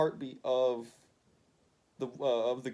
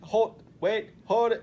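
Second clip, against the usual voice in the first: higher and louder, calling out; calling after; 10 semitones higher, 11 dB louder than usual. Retold or imitated. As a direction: shout after her to stop. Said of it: "Matt Murdock's like".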